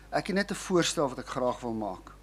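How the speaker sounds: ordinary delivery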